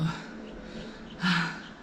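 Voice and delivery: heavy sigh after exercise; breathless sigh. Real, not acted. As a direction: let out a winded sigh. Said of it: "a bit breathless".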